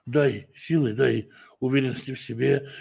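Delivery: ordinary talk, nothing marked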